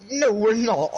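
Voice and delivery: high mock protest; retold disbelieving objection; incredulous refusal. Retells refusing a fight.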